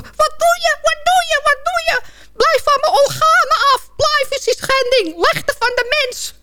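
very high squeaky protest; panicking little device in a squeaky put-on voice; gadget crying out. Phone protests being taken apart, crying out. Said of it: "die Fuyong Ai nog roepen".

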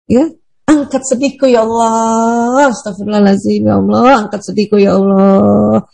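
slow drawn-out plea to Allah; slow pleading prayer. Shown as a demonstration. Teacher demonstrating a prayer to lift sadness, slow and pleading. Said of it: "demonstrates prayer".

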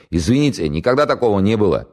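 high, loud, flustered apology. Acted. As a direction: blurt the apology in a panic. Flustered commissar jumps up apologizing.

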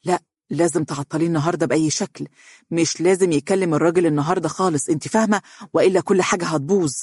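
fast, anxious pleading; quick, nervous urgency; tense and hurried. Acted. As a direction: rush the words, worried it will all fall apart. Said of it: "أصابه التوتر والقلق وقال بسرعة".

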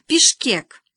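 high, loud triumphant announcement; shouted. A narrator's reading. Boy shouts the remembered capital to classmates, shouting. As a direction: fire the word out loudly. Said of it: "закричал Серёжка".

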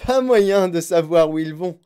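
loud, devilish exclamation; Monsieur Wonka, diabolical cry. A narrator's reading. Chocolate maker in the dark tunnel, diabolically gleeful. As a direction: cry it out with wicked glee. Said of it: "cria Monsieur Wonka dans un diabolique éclat de rire".

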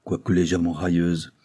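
plain reading voice

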